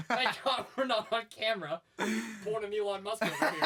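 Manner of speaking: loud, high words through laughter; laughing. Real, not acted. Co-host joking about being off camera, laughing. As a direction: crack up while joking.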